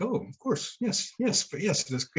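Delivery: high, eager mock reply; the man behind him answers, in a high, eager mock voice; mock eager local. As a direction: play the guy as overly eager. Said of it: "He's like".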